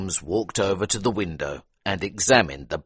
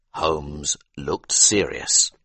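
ordinary delivery, nothing marked

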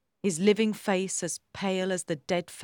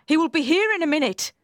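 fast, high alarmed warning; panicky, raised voice; urgent cry; 8 semitones higher, 5 dB louder, faster than usual. A narrator's reading. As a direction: rush the warning out. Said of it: "I cried".

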